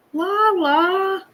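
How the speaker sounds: high singsong on the name. Real, not acted. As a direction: croon the name fondly. Savours the name.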